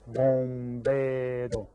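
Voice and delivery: very slow, evenly sustained beats; syllables clapped out. Shown as a demonstration. Teacher leads class clapping out the word, slowly syllable by syllable. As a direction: sound out "Bombero" beat by beat. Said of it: "Clap it out".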